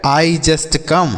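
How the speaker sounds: slow, clear question; slow example. Shown as a demonstration. Teacher slowly models the question for children. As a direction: draw the question out. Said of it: "stop here".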